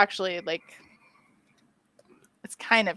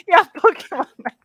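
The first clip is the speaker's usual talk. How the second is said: laughing out the words; 10 semitones higher, 7 dB louder than usual. Real, not acted. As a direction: giggle through it. Laughing at the joke.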